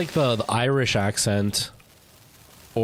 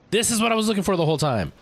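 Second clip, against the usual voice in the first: excited; 10 semitones higher, faster than usual. Real, not acted. Excited discovery continues.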